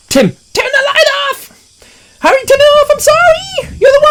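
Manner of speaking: frantic high shriek. Retold or imitated. Girl in the car begging Tim to kill the light, high frantic shriek. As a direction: shriek at your brother in panic.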